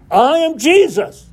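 high, slow, emphatic proclamation; voice from heaven. Retold or imitated. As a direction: proclaim it slowly, pitch soaring on Jesus. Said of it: "Now the voice coming from heaven".

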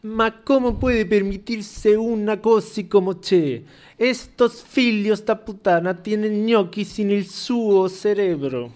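slow, high mock Italian accent; hammed-up Italian accent impression. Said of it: "imitando de nuevo los acentos". (acento italiano exagerado y lento)

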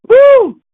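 high, loud whoop of joy; excited whoop. Real, not acted. Preacher fired up in his sermon, loud whoop.

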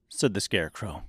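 ordinary delivery, nothing marked

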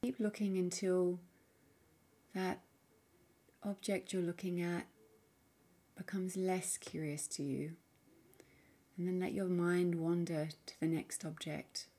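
soft, unhurried meditation voice with long pauses; guided meditation. Acted. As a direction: gently guide the listener inward, leaving space between phrases. Leads a guided mindfulness practice.

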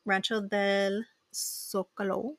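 slowly spelled-out Spanish name; slow, careful. Real, not acted. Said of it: "I always have to say that slow".

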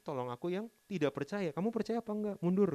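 high loud mock scolding; mock God sending the doubter back; impatient rebuke. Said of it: "gitu kan".

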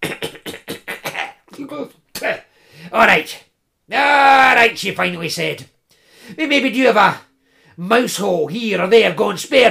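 high, loud grumbling crone; old woman voice. Acted.

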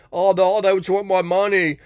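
high, loud mocking whine of a complaining guy; mocking whiny guy. Mocking the guys who fear gold diggers in a high loud whine. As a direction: squeal it like a sulking man. Mocks the excuse guys make.

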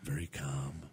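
very low, soft Steven Seagal voice; deep calm murmur, no raise. Shown as a demonstration. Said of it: "I'm gonna talk like this".

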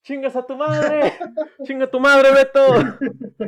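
high, loud rowdy yell. Real, not acted. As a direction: shout it, burning from the tequila. Yelling as the shot burns.

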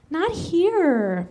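high coy mewl. Acted. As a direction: whimper it coyly. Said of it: "mewled Abe".

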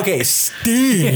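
high, slow drawn-out name; mock grand voice. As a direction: draw it out theatrically. Mocking correction in voice.

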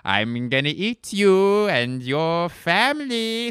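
high, loud, drawn-out howl; hammed-up wolf taunt, slow and swooping; wolf impression. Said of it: "here's my impression of".

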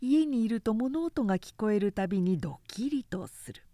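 ordinary delivery

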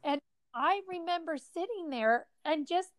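on the edge of tears, holding it together; choked-up sadness. Real, not acted. Friend recalling little Brett crying on the floor, emotional and near tears. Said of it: "it makes me sad every time".

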